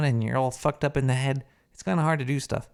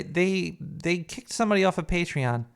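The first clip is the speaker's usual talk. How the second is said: ordinary delivery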